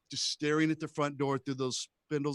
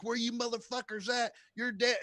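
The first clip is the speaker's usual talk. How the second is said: menacing hillbilly; 8 semitones higher than usual. Retold or imitated. Hillbilly threatening kids.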